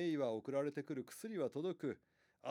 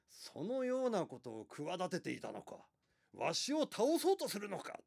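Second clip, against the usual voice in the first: rising, furious accusation from the throne; angry emperor; 5 semitones higher, 5 dB louder than usual. Acted. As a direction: demand it like an outraged ruler.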